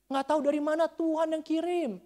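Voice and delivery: high, loud, mounting insistence; emphatic preaching shout. Real, not acted. Preacher testifying that God sent the money, emphatic shout.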